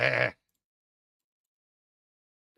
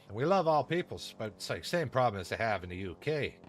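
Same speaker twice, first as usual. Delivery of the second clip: put-on Canadian hoser accent. Streamer to chat, hamming up a mild Canadian hoser accent. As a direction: play the Letterkenny-style Canadian good old boy.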